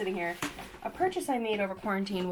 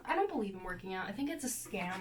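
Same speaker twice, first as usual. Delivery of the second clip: normal talking voice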